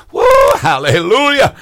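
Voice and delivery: high, whooping hallelujah; exultant praise. Real, not acted. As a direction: burst into joyful praise mid-sermon. Spontaneous praise outburst while preaching.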